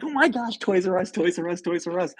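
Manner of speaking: high-pitched giddy squeal, reenacted; freaking out with excitement. Lost driver spotting a Toys R Us sign, freaking out excitedly. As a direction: chant the store name in an excited high voice. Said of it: "And I freaked out".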